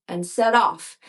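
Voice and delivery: ordinary delivery, nothing marked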